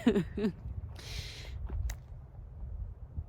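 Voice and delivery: light airy chuckle; soft laugh. Real, not acted. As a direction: chuckle softly at the joke. Laugh after joke.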